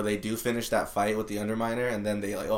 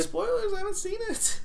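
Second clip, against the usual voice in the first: high mock voice. Joking fake spoiler complaint.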